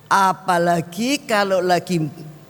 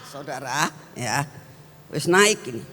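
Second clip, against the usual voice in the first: usual speaking voice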